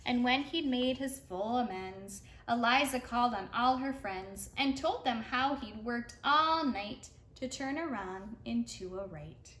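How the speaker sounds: usual speaking voice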